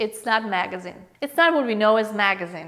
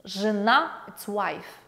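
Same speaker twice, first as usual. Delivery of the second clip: usual speaking voice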